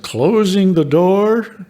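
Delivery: high, drawn-out call; voice rising above others. Retold or imitated. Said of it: "one voice rose above the others".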